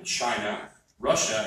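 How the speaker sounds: ordinary talk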